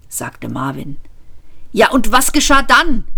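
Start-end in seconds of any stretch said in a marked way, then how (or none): 1.7-3.0 higher-pitched, impatient prompt; urging. A narrator's reading. Ford pushes the robot to finish his story, urgently. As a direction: press eagerly for the rest. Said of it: "drängelte Ford".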